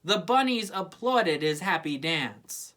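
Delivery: usual speaking voice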